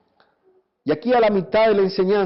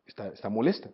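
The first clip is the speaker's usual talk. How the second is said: hushed, low aside of a husband; quiet, low imitated reaction; wary husband; 8 semitones lower, 10 dB quieter than usual. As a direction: murmur it to yourself, realising trouble. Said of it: "Y tú dices".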